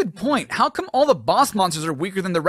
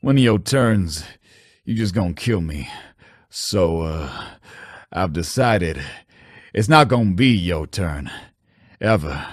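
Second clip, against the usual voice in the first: low menacing character voice; 10 semitones lower than usual. Pretend.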